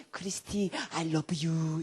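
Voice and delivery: low imitation of loving dad; father's affection. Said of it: "수시로 저희 아버님이". (딸에게 사랑을 전하는 아버지의 낮은 목소리 흉내)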